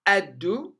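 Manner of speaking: "الدو" as ad-du, lam silent; doubled dal with u. Shown as a demonstration. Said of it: "في المنطقة الحمراء". (نطق "الدو" بدال مشددة مضمومة)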